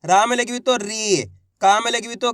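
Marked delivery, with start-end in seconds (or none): 0.8-1.2 री with a long, falling ee; drawn-out vowel. Shown as a demonstration. Teacher drilling the long matra, री drawn out. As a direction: stretch the ee in री. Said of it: "खींच खींच के उच्चारण".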